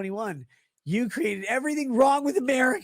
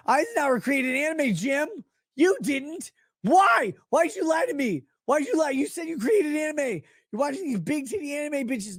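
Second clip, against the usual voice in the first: mock outrage; 6 semitones higher than usual. Streamer rants at Jim, mock outraged and high. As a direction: accuse him in a hammed-up high voice.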